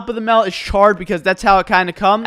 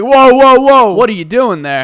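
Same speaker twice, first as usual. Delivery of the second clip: loud, higher mock protest; alarmed outburst; 3 semitones higher, 7 dB louder than usual. Retold customer stopping the worker at the oven, alarmed outburst. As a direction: cut in loudly to halt someone, easing off.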